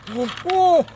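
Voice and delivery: loud, high mock outburst; hammed-up loud climax. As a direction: burst out loudly, imitating an excited student. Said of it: "he gets louder and louder".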